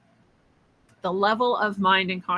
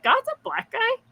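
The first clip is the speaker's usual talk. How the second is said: very high, re-enacted double take; astonished question; 14 semitones higher than usual. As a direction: squeak it out in mock disbelief. Acted surprise at what God looks like.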